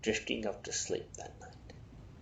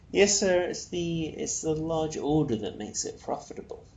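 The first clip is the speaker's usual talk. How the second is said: a hint of a polite, eager salesman; lightly voiced servile hitman salesman; obsequious salesman; 5 semitones higher, 6 dB louder than usual. Kemble pitching murders to Peter, lightly voiced unctuous salesman.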